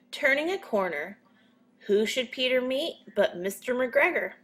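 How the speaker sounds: usual speaking voice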